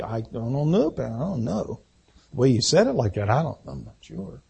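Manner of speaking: soft, hesitant murmur of an unsure listener; unsure churchgoer. Retold or imitated. Doubting listener answering the pastor, soft and unsure. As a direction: mumble it like you doubt. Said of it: "if you happen to be sitting there and not say".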